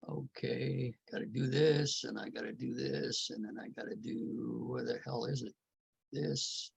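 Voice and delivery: muttering to himself. Real, not acted.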